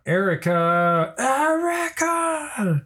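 long, drawn-out high wail; retold scream. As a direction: wail the name slowly, climbing high. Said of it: "screaming out".

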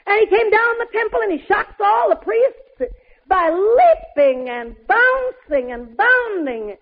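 ordinary delivery

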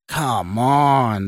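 drawn-out growling goad; rough growl. A narrator's reading. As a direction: growl it slowly, urging him on. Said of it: "with a rough growl".

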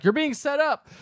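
high, hammed-up urgent reveal; mock dramatic warning. [name] warning Lizzie before the concert, mock urgency. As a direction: pitch it up like a soap-opera warning.

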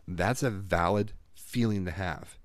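ordinary talk

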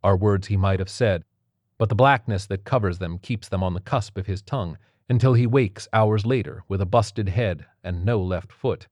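plain reading voice

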